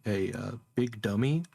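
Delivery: ordinary talk, nothing marked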